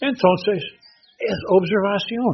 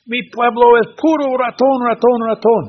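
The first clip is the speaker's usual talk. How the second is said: high, loud excited put-on voice; eager villager; 6 semitones higher, 4 dB louder than usual. Villager tells the permaculture man about rats, excitedly. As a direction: blurt it out like a thrilled villager.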